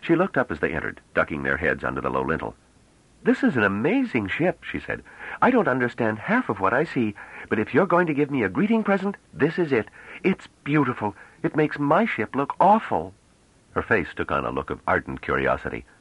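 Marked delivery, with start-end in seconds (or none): 3.2-13.1 put-on high, bright-eyed girlish voice, full of wonder; young woman Bliss, eager. A narrator's reading. Young woman marvelling at the ship, high and excited.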